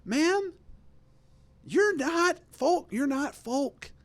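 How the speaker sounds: high, slow mocking rebuke; mock scolding. Host scolding the folksy politician, high mock rebuke. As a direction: draw it out high, as a mocking telling-off.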